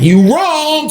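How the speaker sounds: high, drawn-out retort; exclaimed reaction. Retold or imitated. Storyteller reacting to the man on the steps, high exclamation. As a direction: sing it out high on a long "wrong". Gloating reaction as he retells scheme.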